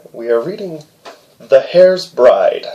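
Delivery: ordinary delivery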